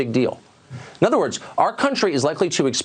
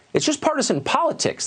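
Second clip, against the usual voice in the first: higher-pitched quote of dismissive politicians; mock dismissive. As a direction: wave it away in a raised, imitating pitch. Quoting leaders who minimize the virus.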